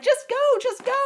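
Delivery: very high, frantic acted cry; yelling. Daughter urging friend to drive away, frantic high yelling. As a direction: re-enact pleading at the top of your range. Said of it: "I was yelling at my friend".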